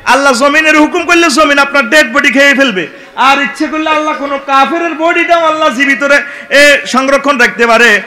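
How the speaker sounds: loud, high fiery sermon; preaching at full force. Acted. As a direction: drive it at the crowd. Preaching to crowd.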